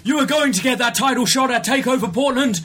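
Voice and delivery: high mock British general manager, stern then giggling; hammed-up British authority voice, laughing through it; William Regal. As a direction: play the pompous GM laying down the law. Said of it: "No, he goes".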